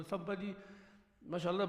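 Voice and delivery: ordinary talk, nothing marked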